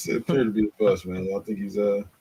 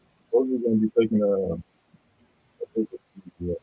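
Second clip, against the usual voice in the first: muffled; 2 semitones higher than usual. Real, not acted. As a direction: hand over mouth, muffled. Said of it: "you kinda, uh, muffled there".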